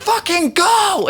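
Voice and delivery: high, slow, desperate "fucking go"; desperate plea. Retold or imitated. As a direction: beg her to drive, drawn out. Victims urging driver.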